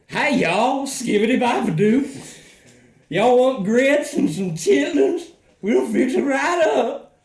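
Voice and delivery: high, loud cartoon Southern twang; exaggerated Southern accent. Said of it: "not all Southern accents sound like".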